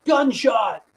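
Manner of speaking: a sudden high shouted alarm; mock yell. Retelling a protest scare, a sudden high yell. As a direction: yell it like a panicked crowd member. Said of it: "Someone reportedly yelled".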